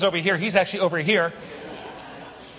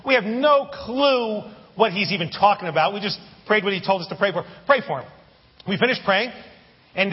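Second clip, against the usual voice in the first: ordinary talk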